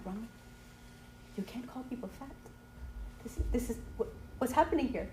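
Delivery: hushed, embarrassed telling-off; retold near-whisper, stunned and very soft; mortified aside. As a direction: scold him under your breath. Retells her mortified aside to husband.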